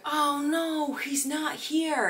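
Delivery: high, slow sing-song oh no; mock disappointed tourist. Imitating the Midwest family in a high put-on voice. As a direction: mimic a sweet out-of-towner's dismay.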